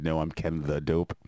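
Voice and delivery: ordinary talk, nothing marked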